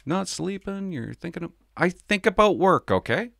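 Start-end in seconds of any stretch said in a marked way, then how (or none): 0.1-1.5 mock concerned commenter. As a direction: parody a worried fan's comment. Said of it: "comments like".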